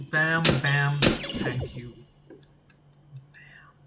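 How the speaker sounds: usual speaking voice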